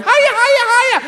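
very high, loud sing-song greeting; shrieking hiya greeting; chirpy lady. Retold or imitated. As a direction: trill it like a posh mum in a 4x4. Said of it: "ladies ... going".

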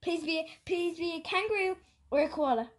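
ordinary talk, nothing marked

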